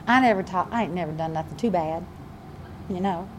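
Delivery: low, hushed Southern self-righteousness; mock-pious Southern accent. Preacher playing a religious person, soft Southern accent. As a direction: play the Southern innocent, softly and low. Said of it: "I get my southern accent going on here".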